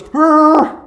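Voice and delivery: a high, loud, noncommittal "Eh" in a mimicked voice; mock unsure eh. Girlfriend answering the proposal, mimicked as a high noncommittal eh. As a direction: tease him with a shrug of a sound. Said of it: "she looks at me and she goes".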